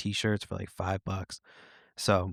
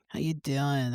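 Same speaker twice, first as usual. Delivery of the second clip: high-pitched mock Boston accent; 5 semitones higher, 4 dB quieter, slower than usual. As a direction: imitate her Boston greeting, pitched up high. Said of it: "in her fucking Boston accent".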